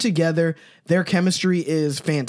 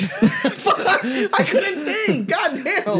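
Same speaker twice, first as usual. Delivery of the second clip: laughing; 12 semitones higher, much slower than usual. Real, not acted. Losing the rhyme drinking game.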